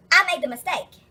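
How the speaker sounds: usual speaking voice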